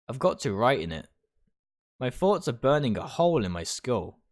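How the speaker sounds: ordinary talk, nothing marked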